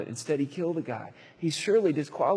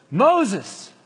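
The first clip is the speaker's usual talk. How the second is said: calling out; 8 semitones higher, 7 dB louder than usual. Acted. God calls again, louder.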